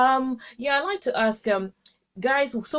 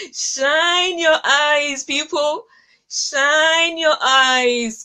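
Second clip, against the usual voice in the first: drawn-out rallying call; 4 semitones higher, 6 dB louder, much slower than usual. Real, not acted. Host rousing a tearful viewer, slow loud rallying call. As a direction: stretch each word slow and loud, higher.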